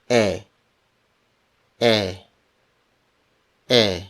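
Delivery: E said as an open "eh"; Filipino E. Shown as a demonstration. Teacher models the letter E for learners, open "eh". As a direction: say E openly as "eh", not "ee". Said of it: "For the letter E".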